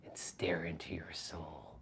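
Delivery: low, hushed, slow intensity; quiet, piercing, deliberate murmur; intense soul-staring talk. Shown as a demonstration. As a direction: drop to a near-whisper, as if staring into someone's soul. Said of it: "talk to you like this".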